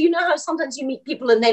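normal talking voice

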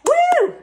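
loud, high joyful whoop; excited whoop. Real, not acted. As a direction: let out a big cheering woo. Cheering after nailing the routine.

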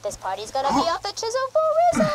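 1 high giddy slang. Acted. Game NPC slang line.

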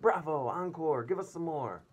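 high, loud theatre cheer; shouting bravo. Acted. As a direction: call for an encore with delight. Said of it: "stood up and shouted".

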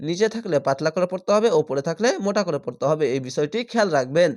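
normal talking voice

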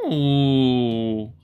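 long, loud falling "ooh"; excited noise. Real, not acted. House builder spotting a wallpaper she likes, excited ooh. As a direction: draw out a delighted ooh, loudly. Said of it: "what is with these noises".

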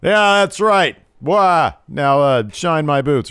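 loud, high cocky gloat; cocky heel. Retold or imitated. Ordering Grimes to shine his boots, loud and cocky. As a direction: gloat like a swaggering wrestler. L A Knight quote.

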